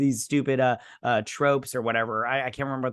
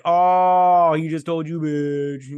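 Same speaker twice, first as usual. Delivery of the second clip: mocking; 3 semitones higher, 7 dB louder, slower than usual. Mocking a character's comeback.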